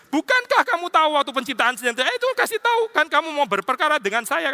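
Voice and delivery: high mocking taunt; God teasing Job in a high, mocking voice. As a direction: needle him playfully, voice climbing high. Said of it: "Tuhan ngeledek lagi".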